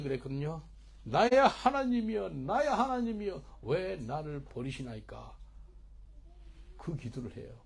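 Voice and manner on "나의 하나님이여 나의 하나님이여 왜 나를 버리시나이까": crying out, fading. Acted. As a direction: start high and loud, sink to a slow lament. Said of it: "그 소리를 지르면서". (크게 부르짖다 느리게 잦아드는 탄원)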